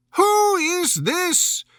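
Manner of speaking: very high, slow, imperious demand; severe Queen. A narrator's reading. Queen of Hearts demanding who Alice is, severe. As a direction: loom over the girl and demand it. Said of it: "the Queen said severely".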